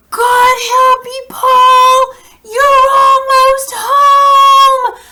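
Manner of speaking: high, loud, long drawn-out holler; yelling encouragement. Acted. Teacher acts Grandpa urging Paul home, long yell. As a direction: stretch the words out like hauling a rope. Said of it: "when he was kinda yelling".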